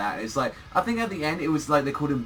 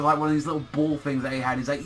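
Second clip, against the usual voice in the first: ordinary delivery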